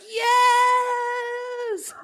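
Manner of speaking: high, loud cheer; excited shout. Retold or imitated. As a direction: yell yes in triumph. Cheering a great scene.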